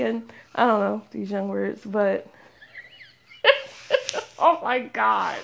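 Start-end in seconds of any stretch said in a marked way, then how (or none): 3.4-5.4 slow oh my God through real laughter; laughing exasperation. Real, not acted. Podcaster realizing how old this sounds, laughing. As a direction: catch your breath between laughs. Cracks up at her own age.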